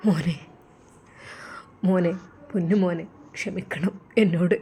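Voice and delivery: low, soft, broken begging; a weeping father begging forgiveness; tearful plea. A narrator's reading. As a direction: beg forgiveness through tears. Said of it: "കണ്ണുകൾ നനയുന്നത്".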